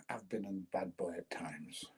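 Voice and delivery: soft whispered confession. Real, not acted. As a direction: drop to a sly whisper. Confessing aside to a viewer.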